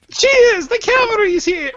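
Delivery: very high female English voice; squeaky mock-English cheer; bad English accent. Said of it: "a bad English voice".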